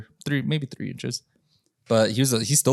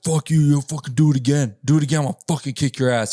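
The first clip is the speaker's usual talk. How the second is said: pissed off; 4 semitones higher than usual. Retold or imitated. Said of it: "He was all pissed".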